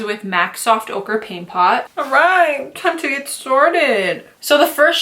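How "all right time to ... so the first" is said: high, drawn-out playful call; playful kickoff. Real, not acted. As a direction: pitch it up and stretch it playfully. Playful launch into the makeup.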